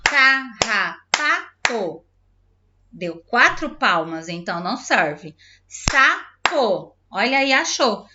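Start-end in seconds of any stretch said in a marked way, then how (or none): 0.0-2.0 "Carrapato" in four slow separate beats; "Carrapato" drawn out, a beat per syllable. Shown as a demonstration. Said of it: "procurar a palavra que tem duas sílabas". 5.7-7.0 "Sapo" split into two beats, higher; "Sapo" as two separate, higher-pitched syllables. Shown as a demonstration. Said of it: "palavra que tem duas sílabas".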